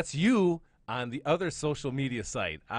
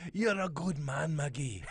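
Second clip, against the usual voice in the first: hammed-up Scots catchphrase; mock Scottish accent; 2 semitones higher, slower than usual. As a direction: draw it out in a fake Scottish brogue. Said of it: "there goes my classic".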